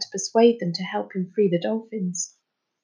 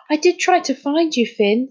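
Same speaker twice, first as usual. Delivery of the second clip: high-pitched, anxious self-defence; 6 semitones higher than usual. A narrator's reading. Friend excusing himself to the boy, anxious. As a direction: plead anxiously that you did try. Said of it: "said Amir anxiously".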